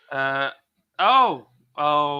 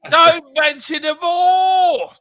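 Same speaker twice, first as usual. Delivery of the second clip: shouted joke line; 9 semitones higher, 8 dB louder, slower than usual. Acted. Fawlty-style shouted joke.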